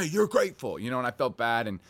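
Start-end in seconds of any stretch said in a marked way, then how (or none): 0.0-0.8 stern correction. Retold or imitated. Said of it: "I wanted to be like".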